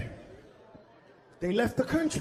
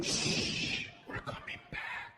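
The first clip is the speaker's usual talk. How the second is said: put-on whispered hush to a child; 10 dB quieter, slower than usual. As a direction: whisper the promise to come back. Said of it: "the little Mexican kid said".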